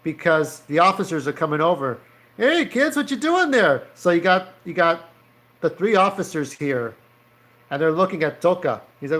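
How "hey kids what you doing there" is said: put-on high-pitched cop calling out to kids; high officer voice.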